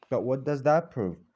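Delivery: usual speaking voice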